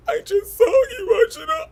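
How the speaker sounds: very high wailing fake sob; mock a fake crier's wail; mock fake crying. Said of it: "I hate when bitches be crying".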